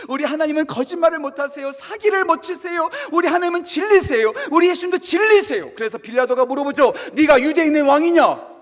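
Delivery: loud, rising pulpit warning; fiery sermon delivery; full-force preaching. Acted. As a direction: hammer home the warning like a preacher.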